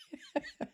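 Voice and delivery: high, soft snicker; quiet high-pitched chuckle to yourself; amused snicker. Real, not acted. Scoffs at the British blame claim.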